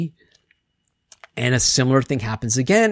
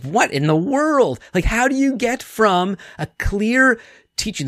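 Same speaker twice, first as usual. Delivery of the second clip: higher, incredulous what-in-the-world; baffled disbelief; 7 semitones higher than usual. Retold or imitated. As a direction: ask it like you can't believe what you're hearing. Incredulous reaction to misunderstanding.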